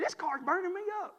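high, loud mimic of his mother's complaint; lightly voiced mother, fussing. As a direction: play Mama griping about the heat. Mother complains about the hot car.

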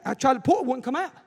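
normal talking voice

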